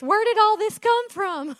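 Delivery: loud, high amazed exclaim; delighted surprise. Real, not acted. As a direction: burst out in happy wonder.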